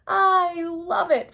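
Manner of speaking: high, loud, slow hoot; big booming uncle's delight. Acted. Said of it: "Fred hooted".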